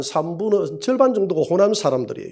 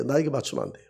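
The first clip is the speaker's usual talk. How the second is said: softer, lower repeat; soft echo; 4 semitones lower, 9 dB quieter, faster than usual. Acted. Pastor repeating his warning to the church, softly. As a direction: let it drop to a quiet echo.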